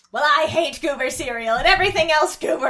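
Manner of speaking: mock angry baby rant; loud mock rant. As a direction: rage at the cereal like a toddler. Tommy rants about goober.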